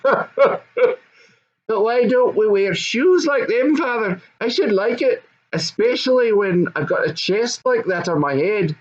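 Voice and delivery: put-on giggly high goblin boy; high goblin son, laughing. Goblin son laughing while asking his father, high. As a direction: laugh through it like a delighted goblin child.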